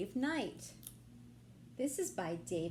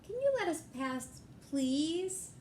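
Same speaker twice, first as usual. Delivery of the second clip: polite plea; 6 semitones higher than usual. Acted. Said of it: "Then Zebra Pete said".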